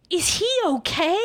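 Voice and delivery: high-pitched, frightened question; acting out a friend's alarm; terrified. Friend asking after the husband's safety, terrified. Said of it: "she looked even more terrified".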